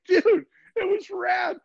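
high, slow, stretched-out surfer rave; gushing dude. Retold or imitated. Bragging to the scoring official, high and slow. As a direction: gush like a clueless happy dude.

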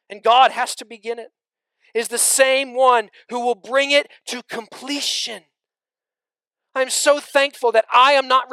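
loud, high, driving sermon delivery; fiery pulpit voice; full-force preaching. Acted. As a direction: preach it out to the whole room.

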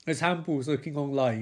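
ordinary delivery

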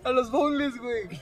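high, laughing disbelief; incredulous retort. Retold or imitated. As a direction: throw the question back, half laughing.

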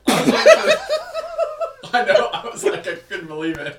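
high, slow, stretched words lost in laughter; cracking up mid-sentence; laughing. Real, not acted. Retelling the Kleenex review to cohost, laughing.

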